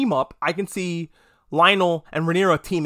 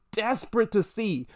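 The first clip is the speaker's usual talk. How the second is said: higher, breathier repeat of "Desperate to see"; eager echo; 4 semitones higher, 4 dB quieter than usual. Shown as a demonstration.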